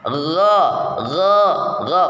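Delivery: gho diucapkan lebih halus daripada kho. Shown as a demonstration. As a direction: gho lembut dari tenggorokan atas. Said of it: "gho ini lebih halus sifatnya". (gho halus, tidak kasar)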